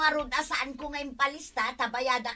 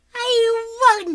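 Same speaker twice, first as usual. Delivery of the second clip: bursts into a loud laugh of surprise; delighted laugh; 12 semitones higher, 13 dB louder than usual. Acted. Auntie recognizing her grown niece, loud delighted laugh.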